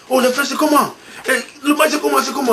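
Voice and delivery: high, eager voice hungry for the result; impatient excited call. Retold or imitated. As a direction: ask it like you can't wait another second. Appel impatient pour le score. (voix haute et impatiente au téléphone)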